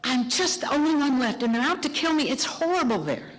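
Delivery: high, complaining plea; whiny complaint. Retold or imitated. Elijah complains to God he is alone, whining. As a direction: whine it up to God, pitched high. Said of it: "And Elijah's like".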